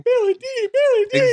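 squealed high and gleeful; like a thrilled fan spotting a star; excited. Pretend. Excited cameo reveal shout-out.

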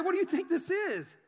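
high, emphatic retort; exasperated exclamation. Retold or imitated. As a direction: imitate an officer blurting the obvious. Officer's exasperated answer, retold.

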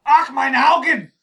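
high, loud German yell; German shout. Acted. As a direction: scream it like a frantic German. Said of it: "I clearly just become an insane German".

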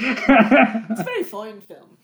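slow, laughing faux-Irish accent; bad British, nearer Irish. Joining the accent game, British turning Irish. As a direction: attempt British, land on Irish. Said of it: "That was more Irish".

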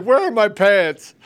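high, loud, slow dazed where are my pants; imitating a stoner. Host repeating the best line, loud and dazed. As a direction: echo his stoner line loudly, dragging it out. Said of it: "It's like".

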